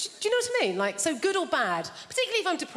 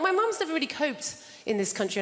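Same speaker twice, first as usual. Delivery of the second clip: normal talking voice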